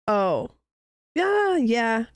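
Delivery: ordinary delivery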